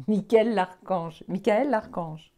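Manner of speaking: loud solemn proclamation; proclaiming. Real, not acted. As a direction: announce the name loudly. Proclaiming the archangel's name.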